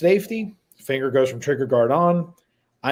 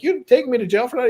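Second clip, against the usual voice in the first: incredulous; 10 semitones higher, faster than usual. Retold or imitated. Incredulous reply to sergeant.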